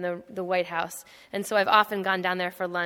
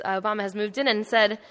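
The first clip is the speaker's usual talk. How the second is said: usual speaking voice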